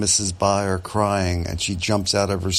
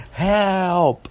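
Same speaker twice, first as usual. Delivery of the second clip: high-pitched scream for help; 13 semitones higher, 4 dB louder than usual. A narrator's reading. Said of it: "she's screaming".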